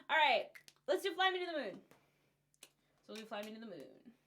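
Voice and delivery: ordinary talk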